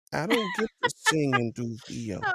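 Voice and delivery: playful envy through a laugh; mock jealousy. Host envying guest who sings and does voice-over, mock-jealous. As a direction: complain jokingly, laughing a little. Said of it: "I'm a little jealous".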